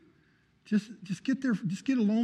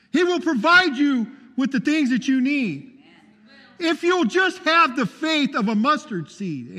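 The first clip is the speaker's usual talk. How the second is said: high, loud preaching, building fervour; preaching at full force; 8 semitones higher, 5 dB louder than usual. Acted. Preacher testifying to his congregation about healing, fervent full-voiced preaching. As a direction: testify like the spirit is moving you, full voice.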